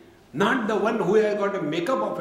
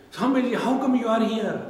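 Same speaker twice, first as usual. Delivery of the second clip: surprised question; 6 semitones higher, 3 dB louder than usual. Retold or imitated. Retold greeting from a surprised fellow.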